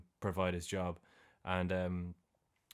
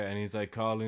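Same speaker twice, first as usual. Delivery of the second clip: usual speaking voice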